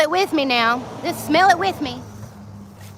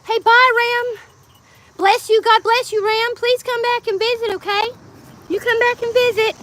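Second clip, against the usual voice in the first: much higher and louder sendoff; cheery farewell call; 9 semitones higher, 3 dB louder than usual. Real, not acted. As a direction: call out to the departing visitor. Calling farewell to visitor.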